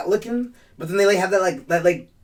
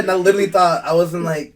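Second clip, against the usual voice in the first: ordinary delivery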